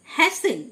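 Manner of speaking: "হ্যাসেন" with S and no T; silent T. Shown as a demonstration. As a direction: model "হ্যাসেন", leaving out the T after S. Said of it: "টি silent". ("হ্যাসেন", টি উচ্চারণ না করে)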